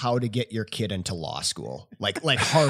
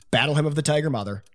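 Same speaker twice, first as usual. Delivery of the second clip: ordinary talk, nothing marked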